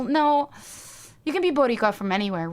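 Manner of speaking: ordinary delivery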